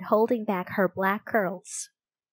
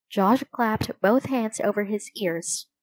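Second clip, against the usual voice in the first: ordinary delivery, nothing marked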